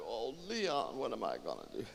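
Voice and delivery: soft, sinking lament. Acted. Woman over Leon's body, quiet helpless lament. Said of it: "She lamented".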